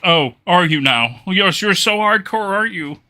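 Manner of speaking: slow, high, loud sarcasm; mock him like a poser; sarcastic mocking. Real, not acted. Sarcastically mocking the edgy kid.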